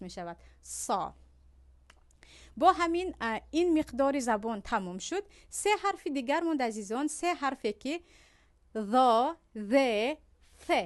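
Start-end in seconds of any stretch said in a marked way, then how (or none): 0.6-1.1 "سا" with a thick ṣ and raised tongue; lower, heavy emphatic s in "سا"; heavy coarse S. Shown as a demonstration. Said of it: "خشین تلفظ". 8.8-10.7 "ذا، ذ، ث" with the tongue tip at the front teeth; lisped th sounds in "ذ" and "ث", heavy in "ذا"; tongue-tip interdentals. Shown as a demonstration.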